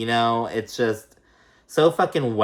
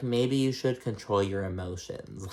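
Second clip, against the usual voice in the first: calm, soft, lower mock of a composed woman; cool as a cucumber; 3 semitones lower, 7 dB quieter than usual. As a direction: say it coolly and quietly, unbothered. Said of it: "cool as a cucumber".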